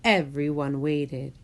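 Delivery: usual speaking voice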